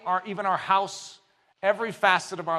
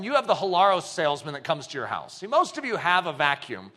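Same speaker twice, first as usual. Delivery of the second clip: ordinary talk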